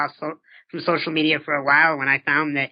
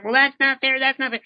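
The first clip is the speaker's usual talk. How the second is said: higher-pitched mock protest; mock complaining; 8 semitones higher than usual. Coach mimicking people who go on and on about fairness, mock complaining. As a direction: parody someone griping on and on.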